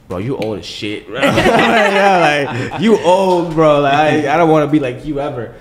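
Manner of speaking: high, loud laughing taunt at his friend; cracking up while roasting an older buddy; teasing laughing jab. Retold or imitated. Host teasing cameraman John, laughing loudly. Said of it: "I just look at him like".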